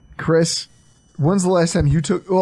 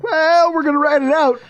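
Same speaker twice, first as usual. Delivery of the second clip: breezy shrug; 11 semitones higher, 3 dB louder than usual. Retold or imitated. Acting out calm GM attitude.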